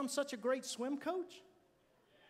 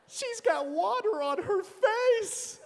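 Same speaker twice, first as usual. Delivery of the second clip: high, slow, loud mock alarm; hammed-up horror over water on her face; mock panicked little girl; 11 semitones higher, 9 dB louder, slower than usual. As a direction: play the girl's shock at wet face. Mocking Sally's panic.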